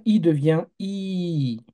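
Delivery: "I" short first, then the second "I" held long, slow; slow contrast of short and long "I"; short then long vowel. Shown as a demonstration. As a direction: stretch the second "I" to two beats. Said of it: "que je lui ajoute cette lettre-là".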